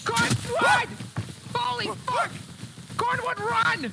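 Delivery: high, frantic shouts to run; panicked yelling. Acted. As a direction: scream for your partner to get away. Screaming at partner to flee.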